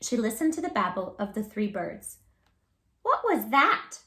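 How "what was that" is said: higher and louder, a little bird startled; startled cry. Acted. Baby bird Flap startled by the bat, high cry. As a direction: yelp it out in surprise. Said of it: "cried Flap".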